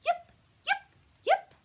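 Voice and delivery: very high, loud yips; fox yipping. Acted. Little fox on the hill calling to the party, very high loud yips. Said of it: "perked up her ears and called".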